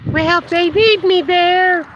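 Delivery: high, slow imitation of his wife; imitating sweet wife. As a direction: mimic her gentle, devoted answer. Wife's reply, voiced sweetly.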